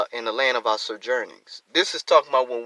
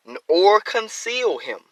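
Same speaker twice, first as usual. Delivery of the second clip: scripture reading; 5 semitones higher than usual. Acted. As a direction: draw out each word of the verse. Verse reading.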